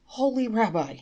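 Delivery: crying out. Acted. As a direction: call out to him in despair. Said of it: "he cried".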